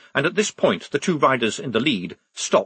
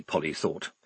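plain reading voice

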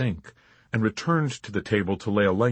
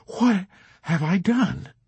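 higher, slow pleading cry; frantic; 10 semitones higher, 3 dB louder, slower than usual. Acted. Chaplain pleading with the major, frantic and high. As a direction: press the question, rising panic. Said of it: "persisted the chaplain more frantically".

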